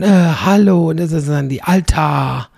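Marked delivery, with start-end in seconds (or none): 0.0-0.9 loud, mock-affronted "hello"; indignant hello. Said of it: "wie wir sagen würden". 1.6-2.5 "Alter" said like "Altar" without the R; "Alter" with a loud, long open final A; drawn-out Alter. Shown as a demonstration. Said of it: "also Altar ohne R".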